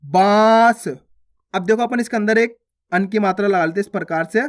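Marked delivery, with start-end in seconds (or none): 0.0-0.9 बांस as long nasal vowel, no n consonant; nasalised vowel. Shown as a demonstration. Teacher adding chandrabindu, long nasalised बांस. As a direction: draw out बाँस through nose and mouth. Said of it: "चंद्र बिंदु की मात्रा लगी तो".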